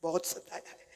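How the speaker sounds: whispered, almost no voice; whispered regret. Acted. Preacher whispering his regret to listeners. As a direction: breathe it out in regret.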